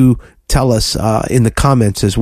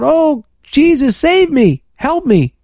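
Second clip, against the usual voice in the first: high-pitched mock plea for rescue, first words drawn out; imitated desperate cry; 12 semitones higher, slower than usual. Preacher acting out Peter sinking on the water, high imitated cry for help. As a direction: cry out for help like a drowning man, hammed-up and high. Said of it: "He immediately cried out".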